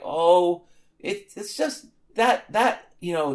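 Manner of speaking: lightly put-on European accent, slow and hesitant; mock European accent. Imagined robot defender makes excuses, mock European accent. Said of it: "a very strong European accent".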